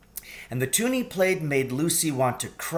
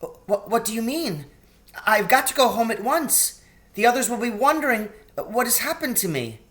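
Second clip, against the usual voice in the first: put-on anxious girl Lucy; 9 semitones higher, faster than usual. A narrator's reading.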